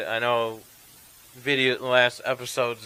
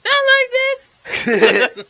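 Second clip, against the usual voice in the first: panicked cry; 13 dB louder, much slower than usual. Retold or imitated. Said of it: "And then he".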